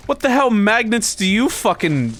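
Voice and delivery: high, baffled outburst; incredulous. Real, not acted. Streamer challenges chat's odd magnet claim, incredulous.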